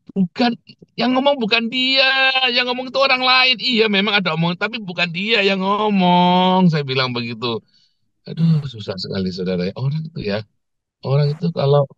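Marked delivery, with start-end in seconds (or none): 0.1-6.7 high-pitched retold rebuttal; exasperated correction. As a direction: re-enact insisting they have the wrong person. Acted rebuttal, "saya bilang begitu. (menirukan bantahan gemas dengan nada tinggi)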